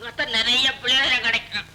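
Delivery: high sarcastic mock plea; mocking retort. Father mocking his daughter's threat in a high sarcastic voice. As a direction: jeer back in a high voice.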